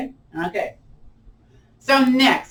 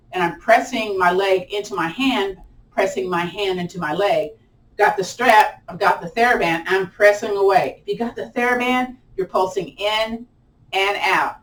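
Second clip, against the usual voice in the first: ordinary talk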